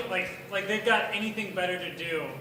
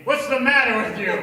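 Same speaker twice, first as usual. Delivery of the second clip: mock yell; 3 semitones higher, 10 dB louder than usual. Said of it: "and you're asking".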